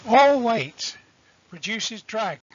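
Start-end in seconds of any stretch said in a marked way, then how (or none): none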